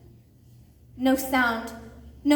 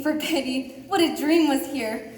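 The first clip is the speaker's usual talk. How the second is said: usual speaking voice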